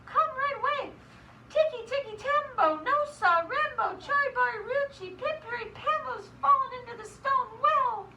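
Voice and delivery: high, urgent calling; storybook boy calling for help; shouting. Acted. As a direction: holler the long name in a high, rhythmic rush. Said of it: "shouted Chang".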